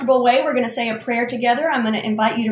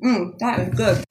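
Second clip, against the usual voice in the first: savoring delicious food. Real, not acted. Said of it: "but I'm gonna have a bite".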